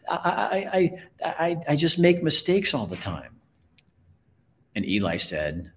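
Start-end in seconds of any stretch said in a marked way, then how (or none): 0.1-3.4 high-pitched, stuttering confession; anxious stammer. Acted. Punchinella confesses mistakes to the toy maker, stammering. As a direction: stumble on the first word, voice pitched high.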